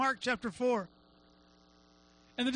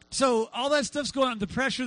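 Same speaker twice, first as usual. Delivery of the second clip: ordinary delivery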